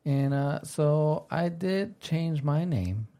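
ordinary talk

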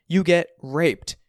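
high, loud, slow and emphatic; blunt confrontation. A narrator's reading. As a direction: spell it out for dad. Son confronting his dad.

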